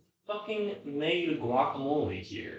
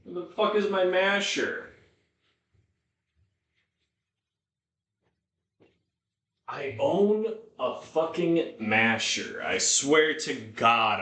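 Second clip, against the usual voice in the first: exasperated; 5 semitones higher, 6 dB louder than usual. Real, not acted. Can't find the masher.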